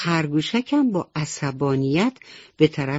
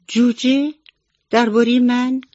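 high, louder startled questions; surprised; 8 semitones higher, 5 dB louder than usual. Acted. The rabbit hears the fox's gossip, startled and surprised. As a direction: jump up in surprise.

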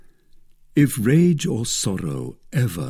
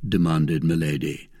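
ordinary delivery

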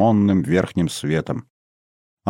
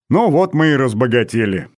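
higher, amused irony; smirking; 4 semitones higher than usual. A narrator's reading. Father joking about the painting, smirking. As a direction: say it with an audible smirk. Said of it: "ухмыльнулся отец".

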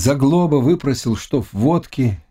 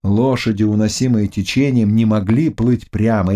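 usual speaking voice

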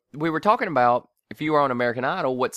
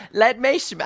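high-pitched, hammed-up English accent; comic English accent with a rising end; attempted English accent; 10 semitones higher, 3 dB louder than usual. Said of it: "I've actually got an English accent".

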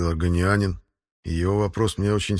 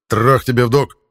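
loud, higher battle cry; shouted command; 4 semitones higher, 7 dB louder than usual. Acted. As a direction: bark the code phrase out. Я немедля произнёс. (громкий выкрик)

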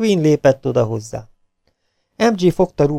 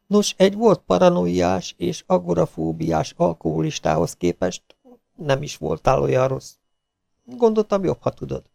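lightly voiced consoling colleague; 7 semitones higher than usual. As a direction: offer gentle, slightly awkward reassurance. Novel character consoles a colleague.

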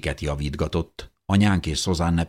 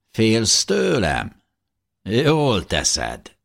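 higher-pitched villain voice, drawn-out question; menacing officer's words remembered; 7 semitones higher, 4 dB louder, much slower than usual. A narrator's reading. Söffer's words echoing in the narrator's head, taunting. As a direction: taunt them in a raised, drawn-out voice. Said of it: "Söffer szavai zúgtak a fejemben".

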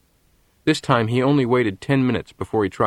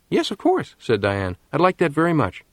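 put-on girl's reply, much higher, delighted; Diane's happy yes; 6 semitones higher than usual. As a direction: Diane gladly agrees on the phone, voice high and pleased. Diane accepts his invitation.

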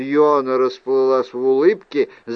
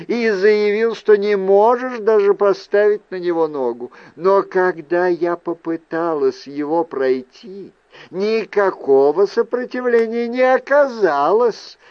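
Fiona building her case in a raised pitch; accusing aunt; 6 semitones higher than usual. Acted. Aunt confronts nephew over his deception, accusing. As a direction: press the charge, voice lifted.

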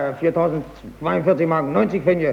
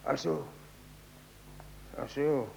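enttäuschtes Murmeln; 3 semitones lower, 11 dB quieter than usual. Acted.

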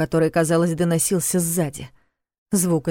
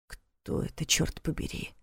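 hushed, nearly voiceless; quiet question; 12 dB quieter, slower than usual. A narrator's reading. Vanya asks about a stranger in the shadows, quietly. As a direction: ask it under your breath, very softly. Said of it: "тихо спросил Ваня".

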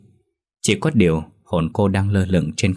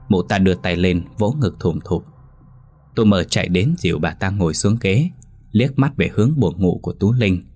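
ordinary delivery

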